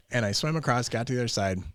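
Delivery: normal talking voice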